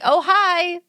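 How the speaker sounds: fake polite. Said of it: "I'm like".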